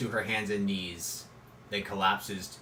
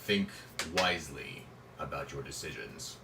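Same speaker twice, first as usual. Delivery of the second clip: deep booming voice; 6 semitones lower, 8 dB quieter than usual. Acted. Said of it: "The voice says".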